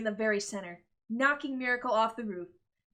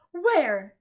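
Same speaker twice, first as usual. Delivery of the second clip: startled where; 4 semitones higher, 11 dB louder than usual. Acted. Miracle startled.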